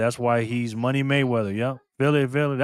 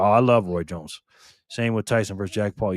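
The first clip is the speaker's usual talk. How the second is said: ordinary delivery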